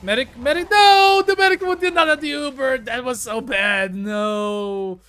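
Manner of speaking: frantic high shout for medic; man down shout. Real, not acted. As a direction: yell in panic as he dies. Teammate dies mid-game.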